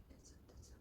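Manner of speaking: barely audible whispered prayer; whispered plea. Acted. Candidate pleading to God before hearing the job news, whispered. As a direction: whisper it urgently under your breath.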